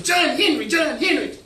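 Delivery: high, loud crowd cheer; cheering yell. A narrator's reading. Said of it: "Folks were cheering and yelling".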